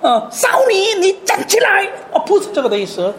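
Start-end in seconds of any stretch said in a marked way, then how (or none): 0.0-1.9 much higher, loud calling-out of the command; imitating a harsh shout at the girl; mock loud command. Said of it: "在那边很大声说".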